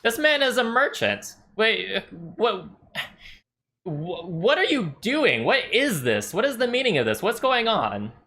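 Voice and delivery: high, sputtering alarm; flustered merchant. Acted. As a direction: caught off guard and scandalised. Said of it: "he's like".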